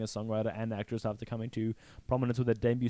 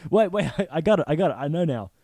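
laughing eureka, higher and louder; excited realization; 4 semitones higher, 5 dB louder than usual. Retold or imitated. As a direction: blurt it out through giggles.

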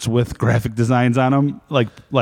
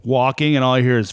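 ordinary talk

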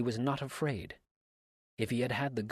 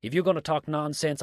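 higher and louder, an exasperated cry; cried out; 6 semitones higher, 8 dB louder than usual. A narrator's reading. Dix protests to Abner, loud exasperated cry. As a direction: call his name in protest. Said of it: "he cried".

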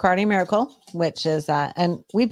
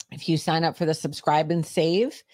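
normal talking voice

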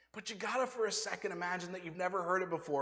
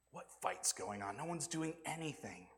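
usual speaking voice

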